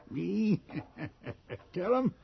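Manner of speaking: incredulous high yelps broken by a laugh; mocking how-dare-you, pitched high; scoffing disbelief. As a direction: laugh off the suggestion. Mocking the very idea.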